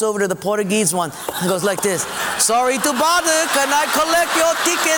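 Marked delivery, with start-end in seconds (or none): 2.4-5.0 trick them like a sly conductor; mock conductor. Japanese guy fakes conductor voice.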